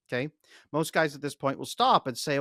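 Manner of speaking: ordinary talk, nothing marked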